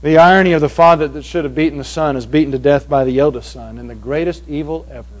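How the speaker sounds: ordinary talk, nothing marked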